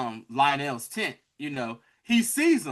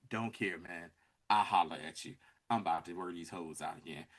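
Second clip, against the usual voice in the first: low, quiet brush-off; mock indifference; 11 semitones lower, 10 dB quieter than usual. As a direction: imitate a lord dismissing someone under his breath. Retold lord brushing the knight off.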